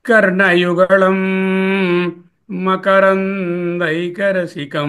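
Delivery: slow, drawn-out chanting of the Sanskrit line; slow verse recitation. Shown as a demonstration. Teacher reciting a verse to students, slow and chanted. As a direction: intone each syllable as scripture.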